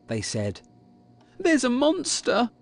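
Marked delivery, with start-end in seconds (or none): 1.4-2.5 high, upset child's voice, acted; mild acted tearfulness, pitched high; sobbing. A narrator's reading. Kipper telling his parents about the monster, mildly tearful. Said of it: "he sobbed".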